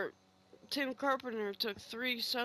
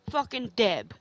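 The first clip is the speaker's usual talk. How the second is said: a loud, bitter curse at the neighbour; angry outburst; 8 dB louder than usual. Real, not acted. Furious about the neighbour who ignored her fallen dad, angry outburst. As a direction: spit out the name in real anger.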